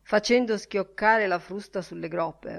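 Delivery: ordinary delivery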